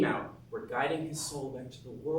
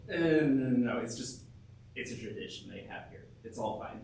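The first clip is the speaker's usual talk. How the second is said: usual speaking voice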